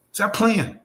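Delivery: dismissive. Real, not acted.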